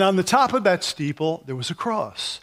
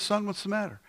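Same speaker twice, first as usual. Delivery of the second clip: gentle cop; 4 semitones higher, 8 dB quieter than usual. Retold or imitated. Cop comforting lost crying boy.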